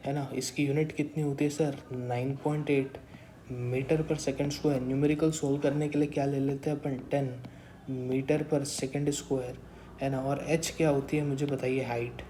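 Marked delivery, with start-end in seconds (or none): 1.9-4.8 slow dictation. Shown as a demonstration. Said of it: "dictating the value slowly". 7.1-9.6 slow dictation. Shown as a demonstration. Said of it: "dictating the value slowly".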